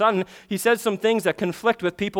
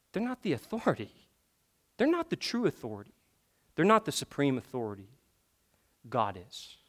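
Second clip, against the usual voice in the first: much softer, stressing not; quiet emphasis; 4 semitones lower, 7 dB quieter than usual. Acted. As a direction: drop low and press each point. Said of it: "quiet emphatic close".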